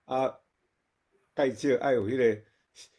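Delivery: ordinary delivery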